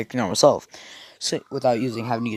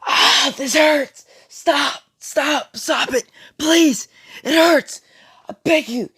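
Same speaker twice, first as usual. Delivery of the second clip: high, loud, slow anguished scream; much higher, loud pleading shrieks of pain; 8 dB louder, slower than usual. Acted. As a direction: scream and beg as if it's tearing you apart. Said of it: "Naruto starts to scream and says".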